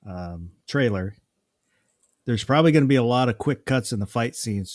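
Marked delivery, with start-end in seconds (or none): none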